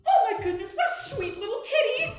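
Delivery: high, loud cooing over a pet; excitable wizard baby talk; gushing wizard. Acted. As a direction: squeal with delight at a kitten. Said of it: "blue-robed wizard with a floppy hat going".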